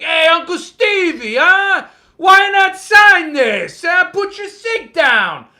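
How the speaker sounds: loud, high, slow mock heckle; [name] as loud pushy fan. Fan badgering the author to sign, loud teasing shout. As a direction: shout up at Uncle Stevie, teasing. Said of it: "you'd be like".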